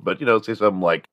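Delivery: ordinary talk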